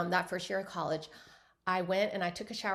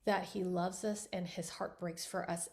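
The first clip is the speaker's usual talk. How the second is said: ordinary talk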